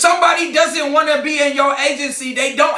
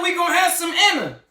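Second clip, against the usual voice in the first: defiant shout; 6 semitones higher, 3 dB quieter, faster than usual. Real, not acted. Answering own taunt, shouting.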